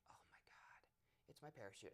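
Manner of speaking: soft, breathless whisper of embarrassment; embarrassed whisper. Retold or imitated. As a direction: hush it out, mortified.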